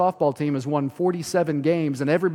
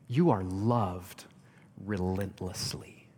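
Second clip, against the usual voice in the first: tender; 6 semitones lower, 4 dB quieter, slower than usual. Real, not acted. Tender repeat of the promise.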